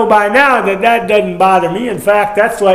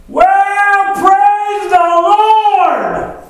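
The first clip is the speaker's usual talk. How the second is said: high-pitched drawn-out holler of praise; excited church matriarch; 14 semitones higher, 4 dB louder, much slower than usual. Retold or imitated. Old church aunt moved by a blessing, mock ecstatic holler. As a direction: wail it out slowly, way up high, hammed-up. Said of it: "given to fits of excitement".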